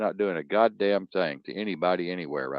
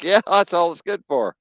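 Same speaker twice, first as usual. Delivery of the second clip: gleeful retort; 10 semitones higher, 6 dB louder than usual. Retold or imitated. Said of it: "I'm like".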